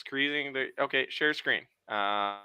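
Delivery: ordinary delivery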